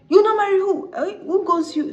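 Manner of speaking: very high, loud hammed-up fury; outraged challenge. Groom raging at the bride refusing him, outraged challenge. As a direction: explode in high-pitched mock disbelief.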